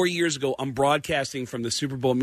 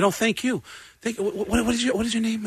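higher-pitched stammering politician voice; Giuliani; 7 semitones higher, faster than usual. Retold or imitated. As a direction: fumble politely through thanks and a question. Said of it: "Giuliani was walking through the media center".

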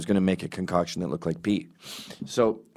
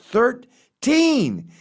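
much higher, louder insistence; exasperated repeat; 5 dB louder than usual. Retold or imitated. David repeating the floor to Nick, exasperated. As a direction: spell it out, losing patience.

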